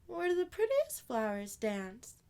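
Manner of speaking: put-on high little girl, sadly. Amani grieving her dead flowers, high childlike voice. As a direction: play a small girl mourning her flowers.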